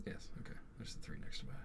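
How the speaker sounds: hushed aside to himself; whispering. Real, not acted. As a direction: drop to a whisper. Checking turn quietly during game.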